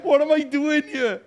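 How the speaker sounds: loud, high-pitched mock wailing; hammed-up helpless wail, near breakdown; comic panic. Lost visitor thousands of miles from home, comic panic. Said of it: "I genuinely felt like that".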